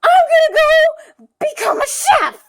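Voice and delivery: tearful declaration. Acted. Acts the boy's declaration.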